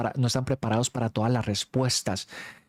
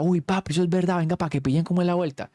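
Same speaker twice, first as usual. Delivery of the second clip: excited urging; 6 semitones higher than usual. Retold or imitated. Friend insisting, urging them over.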